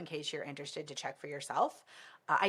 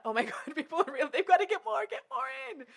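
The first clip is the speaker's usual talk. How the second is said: excited gush; 11 semitones higher, faster than usual. Retold or imitated. Excited quote of own thought.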